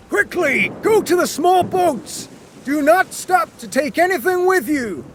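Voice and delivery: high, urgent shouted commands; urgent shouting. Acted. Captain urging passengers to the small boats, high urgent shout. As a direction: hurry them to the boats. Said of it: "the captain shouted to everyone".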